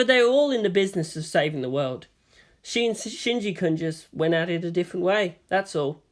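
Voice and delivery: usual speaking voice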